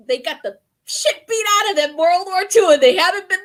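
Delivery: high, loud, cracking up; loud, high-pitched amusement breaking into laughter; laughing. Real, not acted. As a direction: talk through real laughter. Cracking up over complacency joke.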